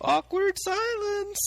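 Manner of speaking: very high, drawn-out singsong; playful high-pitched announcement; mock-awkward singsong. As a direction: tease the awkward moment in a high, slow voice. Joking over awkward moment.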